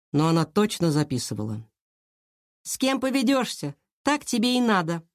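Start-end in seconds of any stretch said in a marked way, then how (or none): 2.6-5.0 put-on high-pitched girl's taunt; defiant. A narrator's reading. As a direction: needle her in a high, challenging voice. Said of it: "Она вызывающе уставилась на Киру". (вызывающе, тонким голоском)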